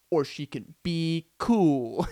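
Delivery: "cool" drawn out long and slow; stress on COOL. Retold or imitated. Narrator lays out Stacy's last option, drawing out "cool". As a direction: stretch out "cool" as the big final option.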